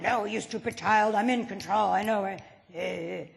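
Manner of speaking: stern father scolding a child, lightly played; daddy tone. Husband answering his wife in a lightly played stern daddy tone. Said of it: "he answers you in daddy tone".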